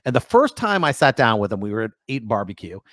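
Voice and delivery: ordinary delivery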